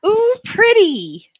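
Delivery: awed delight. Pretend. Character admires strange tree.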